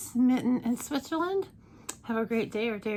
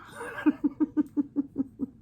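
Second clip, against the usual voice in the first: laughing; 6 semitones higher than usual. Real, not acted. Laugh after the joke.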